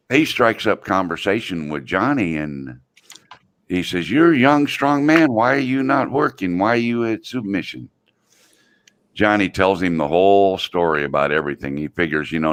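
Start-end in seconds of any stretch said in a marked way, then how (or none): 4.1-7.9 put-on high, broken-English old Chinese man voice; old Chinese man imitation. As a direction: play the little old Chinese guy, pitched up. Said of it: "this little old Chinese guy".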